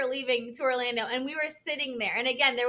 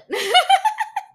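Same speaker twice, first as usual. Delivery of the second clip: sudden high laughing burst, much louder; loud burst of laughter; 10 dB louder than usual. Real, not acted. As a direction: let a big real laugh break out.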